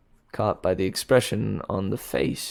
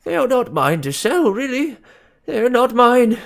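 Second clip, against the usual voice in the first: much louder and higher, pointed; oddly loud; 14 semitones higher, 9 dB louder than usual. A narrator's reading. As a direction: say it for a hidden listener to hear. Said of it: "in an oddly loud tone".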